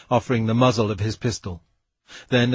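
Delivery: plain reading voice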